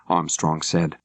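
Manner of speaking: plain reading voice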